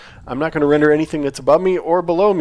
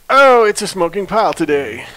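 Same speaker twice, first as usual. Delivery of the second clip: higher, louder mock dismay; imitated dismayed user; 7 semitones higher, 6 dB louder than usual. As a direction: play a user finding the build broken. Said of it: "Yeah, you're like".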